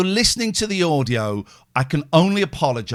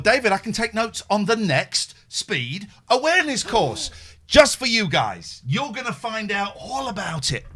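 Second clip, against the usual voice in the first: high, punchy showman's build-up; mock-grand promise; 6 semitones higher than usual. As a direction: spell it out with showy relish. Comic announcement to listeners.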